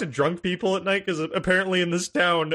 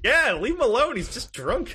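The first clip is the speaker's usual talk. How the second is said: goody-goody voice; 7 semitones higher than usual. Retold or imitated. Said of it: "Johnny Boy Scout over here".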